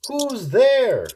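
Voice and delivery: startled mouse cry. Acted. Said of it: "Mouse cries".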